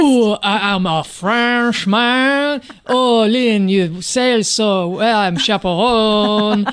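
slow, drawn-out mock French accent; fake French accent. Mocking chaperone Claude to Lynn, hammy French accent. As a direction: ham up a bad Frenchman. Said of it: "Claude, the fake Frenchman".

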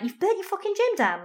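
high-pitched incredulous reaction; mock disbelief. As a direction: react aghast to a casual confession, voice shooting up. Retold disbelief at casual confession.

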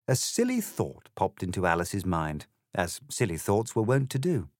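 ordinary delivery

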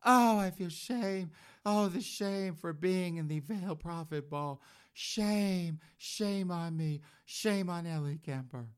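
hammed-up tearful confession of shame.

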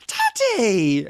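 put-on loud, high, stretched-out toddler call; imitating toddler. Parent retelling the toddler's morning greeting, imitating toddler. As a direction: squeal it like a small child calling out. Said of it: "looked at me again and went".

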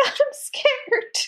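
very high, laughing mock whimper; mock scared. Mocking Kim cowering from the dog, high mock fear. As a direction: squeak it out like a frightened little girl. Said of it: "so funny when she's like".

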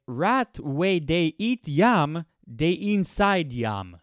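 slow, high, loud put-on Pidgin proverb; mock Pidgin accent. Reading a Pidgin proverb to listeners, in a mock Pidgin accent. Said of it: "Pidgin proverbs".

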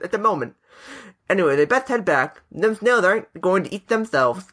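put-on Cockney, reading a letter; Cockney accent. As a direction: read on in an amateur Cockney. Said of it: "do it in a Cockney accent".

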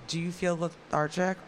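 ordinary talk

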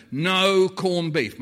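slow, loud "No corned beef" like a public notice; mock warning. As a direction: proclaim it again as an official ban. Warning repeated, announcement style.

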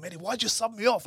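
high, indignant complaint to the coach; acted-out exasperated plea; re-enacted outraged protest. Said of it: "I've lost emotional control".

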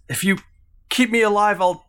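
high desperate bargaining; desperate captive. Acted. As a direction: bargain for your life. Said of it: "She goes".

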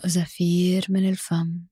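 slow, calm breathing cues; guided breathing. Acted. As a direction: count the breath slowly and softly.

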